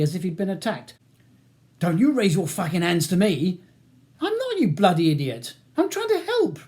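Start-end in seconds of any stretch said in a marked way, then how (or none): 1.8-3.6 loud, higher, aggressive threat; furious warning. A narrator's reading. Ronnie reacting as if attacked by Charles, furious. 4.2-6.6 raised voice climbing very high; exasperated protest. A narrator's reading. Charles protesting to Ronnie he meant no attack, exasperated. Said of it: "protests Charles".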